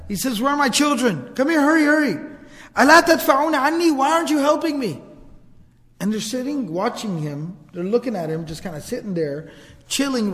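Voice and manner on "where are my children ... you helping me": higher, urgent pleading shout; acted frantic plea for help; calling out in panic. A man calls his children to help him, frantic raised voice. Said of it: "he calls out to his family".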